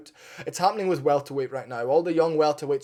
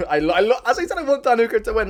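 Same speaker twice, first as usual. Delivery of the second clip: laughing, higher and louder; 9 semitones higher, 9 dB louder, faster than usual. Real, not acted. Admitting to viewers he wants Hooker, laughing loudly.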